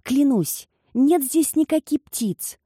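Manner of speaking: high, slow soothing plea; calm a frightened old man; reassuring servant. Acted. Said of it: "говорил Вальдо, стараясь его успокоить".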